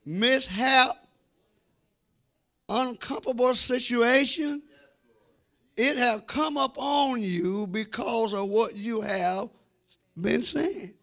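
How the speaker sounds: ordinary talk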